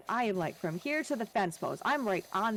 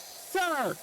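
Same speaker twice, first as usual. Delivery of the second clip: half shout; 7 semitones higher than usual. Retold or imitated. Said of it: "I'm almost shout".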